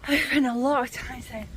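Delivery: ordinary talk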